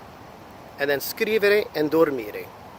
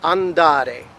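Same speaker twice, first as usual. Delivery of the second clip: "andare" with the emphasis on DA; stress on middle syllable. Shown as a demonstration. Teacher giving another ARE verb, stressing DA in "andare". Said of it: "Other verbs such as".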